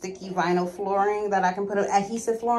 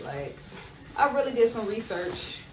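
ordinary delivery

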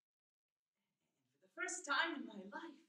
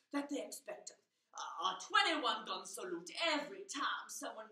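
loud, low-swooping public tirade; indignant speechifying, punching key words; blustering politician; 3 semitones lower, 7 dB louder than usual. Acted. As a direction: thunder at the crowd, building to a shout.

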